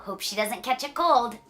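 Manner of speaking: ordinary delivery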